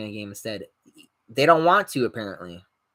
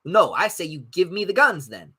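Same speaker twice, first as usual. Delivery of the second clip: ordinary talk, nothing marked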